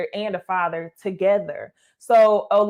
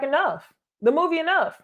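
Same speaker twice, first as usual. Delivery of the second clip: usual speaking voice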